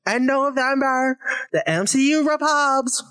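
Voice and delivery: lightly put-on stump-speech accent; mock campaign politician. Campaigner promising a November win, in mock politician style. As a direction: announce it like a rally promise.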